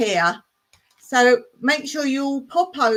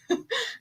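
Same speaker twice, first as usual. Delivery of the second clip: giggle; 14 semitones higher, 6 dB quieter than usual. Real, not acted.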